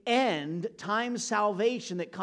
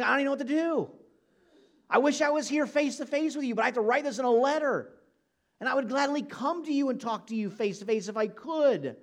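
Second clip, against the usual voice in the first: higher and louder, arms thrown up in frustration; exasperated outburst; 5 semitones higher, 3 dB louder, faster than usual. Retold or imitated. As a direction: burst out, helpless and exasperated. Paul throws up his arms.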